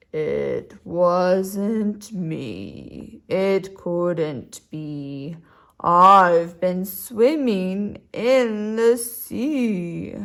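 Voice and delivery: very slow, low turtle drawl. Acted. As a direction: stretch every word like a sleepy turtle. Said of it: "Because turtles talk slowly".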